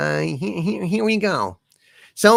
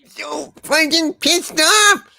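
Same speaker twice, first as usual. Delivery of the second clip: high, slow mock-crying complaint; high whiny outrage; 13 semitones higher, much slower than usual. Streamer voicing a character wailing over the fish joke, high mock cry. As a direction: whine like it wounded you.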